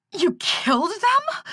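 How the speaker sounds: high, slow, horrified disbelief; sickened and aghast. A narrator's reading. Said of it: "Sickened and aghast".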